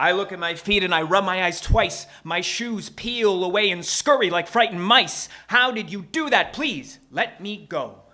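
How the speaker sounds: loud, high, urgent storytelling; alarmed pleading. Acted. Startled narrator pleads with shoemaker.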